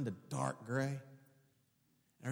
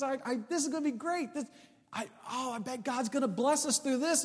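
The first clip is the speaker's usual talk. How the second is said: high-pitched, eager gushing; excited wife; 11 semitones higher than usual. Retold or imitated. Wife telling her husband about the yard sale, high and excited. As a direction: gush with hopeful excitement, pitched up.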